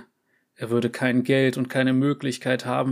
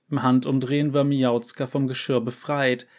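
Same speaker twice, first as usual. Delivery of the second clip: plain reading voice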